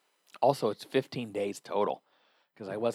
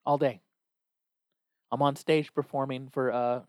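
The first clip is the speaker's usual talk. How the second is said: usual speaking voice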